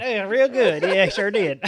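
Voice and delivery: laughing Georgia country voice; country accent. Pretend. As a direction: keep the Georgia twang going, laughing. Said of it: "I can turn my country accent on".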